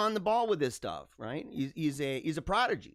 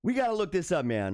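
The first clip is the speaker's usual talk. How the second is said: usual speaking voice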